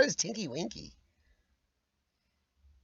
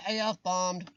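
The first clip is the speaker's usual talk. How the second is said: ordinary delivery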